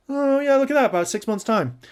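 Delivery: high, loud, breezy airhead voice; mock Rachel. Mocking Rachel planning to cheat again, high and breezy. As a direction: toss it off gleefully as if it's nothing. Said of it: "can you imagine Rachel goes like".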